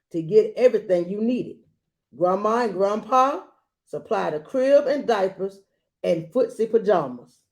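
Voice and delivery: put-on gentle read-aloud to a child; storybook reading voice; story narration. A narrator's reading. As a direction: read it like a bedtime picture book. Reads picture book aloud.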